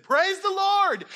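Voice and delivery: high, loud, drawn-out hallelujah cry; mock rejoicing. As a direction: exult over it like a comic revival shout. Rejoicing over favourite ice cream.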